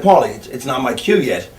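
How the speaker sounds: low, quiet, unbothered; doctor calmly refusing Paulie, low and quiet; calm and cool. Retold or imitated. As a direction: stay cool under pressure. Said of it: "completely cool under pressure".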